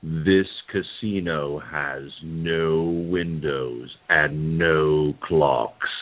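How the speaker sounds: low, slow spooky ghost host; deep haunted-mansion narrator voice; Paul Frees ghost host voice. Retold or imitated. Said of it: "I'll use Paul Frees's ghost host voice".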